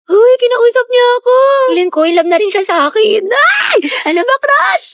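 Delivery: high-pitched lovestruck gushing; kilig squealing. Acted. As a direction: squeal that your crush noticed you. Girl squeals crush talked to her. (tumitili sa kilig)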